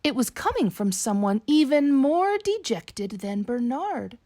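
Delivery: ordinary delivery, nothing marked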